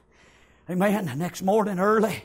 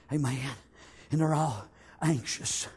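normal talking voice

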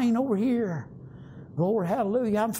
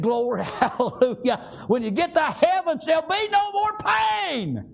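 climbing higher and higher with a laugh, slowing at the end; ecstatic praise; 8 semitones higher than usual. Real, not acted. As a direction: burst into joyful shouting praise. Preacher erupts in ecstatic praise.

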